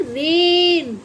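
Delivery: रेन said with a drawn-out long ए; long vowel rain. Shown as a demonstration. Teacher drawing out रेन for the children. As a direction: stretch the vowel in रेन slowly. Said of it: "रैन तो नहीं बोलते".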